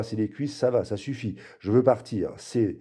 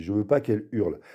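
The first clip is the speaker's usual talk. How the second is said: ordinary delivery, nothing marked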